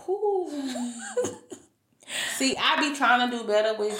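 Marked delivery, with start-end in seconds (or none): 0.0-0.9 high whew into a laugh. Real, not acted. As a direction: blow out then laugh. Laughs at church remark.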